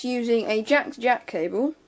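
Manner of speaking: ordinary delivery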